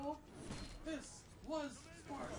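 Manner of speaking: high, slow, stretched-out war cry; mock battle cry. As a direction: draw out each word like a heroic battle cry.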